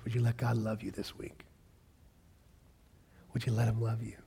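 gentle whispered question. Acted. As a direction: ask it softly, barely voiced. Tender challenge to listeners.

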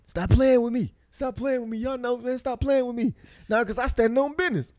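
high-pitched mock whining outburst; imitated upset protest. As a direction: imitate a worked-up kid insisting. Imitates upset son protesting to laughing family.

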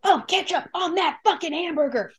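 loud, high yelling manager; angry boss shout. Retold or imitated. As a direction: scream it like a furious boss. Voicing a yelling fast food boss.

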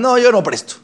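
hammed-up burned lender; curt refusal. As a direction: shut the door on him. Lender refusing after being cheated.

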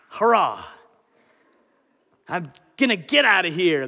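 hammed-up, punchy mock celebration; mock cheer. Preacher parodies a simplistic view of salvation, mock cheer. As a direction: cheer it like a naive escapee.

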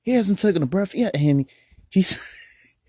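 high-pitched astonishment; amazed. Real, not acted. Amazed at rapper's breath.

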